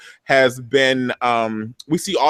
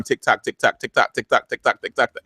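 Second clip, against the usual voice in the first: rapid, ticking repetition; super fast talking. Shown as a demonstration. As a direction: rattle it off like a racing clock. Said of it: "I'm gonna be talking like that".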